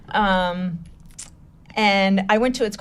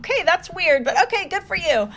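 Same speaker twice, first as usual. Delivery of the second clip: much higher, mock-baffled voice; puzzled politeness; 9 semitones higher than usual. Business peers reacting to her borehole charity, puzzled politeness. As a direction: imitate someone politely weirded out.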